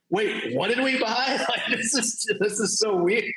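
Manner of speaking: high, laughing imitation of puzzled publishers; laughing mock confusion. Mocking the confused publishers, high and laughing. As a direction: giggle through the publishers' confusion.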